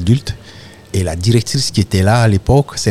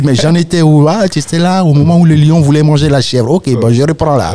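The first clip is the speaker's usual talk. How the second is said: higher, louder lively teller picking the tale back up; playful storyteller resuming; 7 semitones higher, 4 dB louder than usual. Retold or imitated. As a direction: play the griot asking the kids where he was. Storyteller resumes tale after break.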